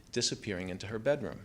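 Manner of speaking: plain reading voice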